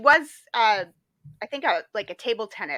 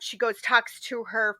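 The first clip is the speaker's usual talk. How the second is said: normal talking voice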